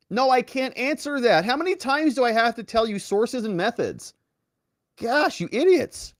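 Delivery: exasperated brush-off. Retold or imitated. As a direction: sigh it out like you're sick of dumb questions. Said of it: "Christopher Wray's like".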